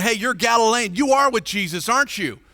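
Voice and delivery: high, loud cornering accusation; accusing crowd. Retold or imitated. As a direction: press him hard into a corner. People accusing Peter.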